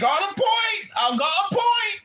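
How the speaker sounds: mock-outraged put-on character yelling at the room; put-on comic voice. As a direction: rant in a loud, high, put-on voice. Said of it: "I guess I can stop talking like this now".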